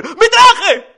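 very high, loud squeal; horrified yelp. A narrator's reading. Papá Noel learning his suit is stained, horrified squeal. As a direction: shriek it in mock horror.

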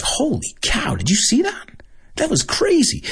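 high-pitched excited disbelief; amazed exclamation. Acted. People reacting to something crazy, high amazed exclamation. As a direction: act astonished, voice jumping way up.

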